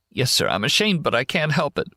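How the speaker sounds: tearful. Acted. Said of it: "a man sitting against that big tree crying".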